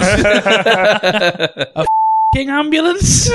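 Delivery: slow, laughing repeat in disbelief. Real, not acted. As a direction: laugh through the question.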